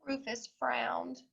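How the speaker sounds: ordinary delivery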